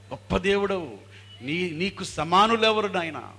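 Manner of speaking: high, loud adoring praise; దావీదు extolling God. Acted. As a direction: cry out in worship like a psalmist. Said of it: "అని వర్ణిస్తూ ఉంటాడు అనమాట దావీదు మహారాజు".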